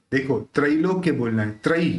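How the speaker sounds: ordinary talk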